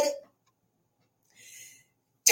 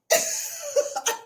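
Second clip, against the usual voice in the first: high cackle; 12 semitones higher than usual. Real, not acted. After joke about killing critics.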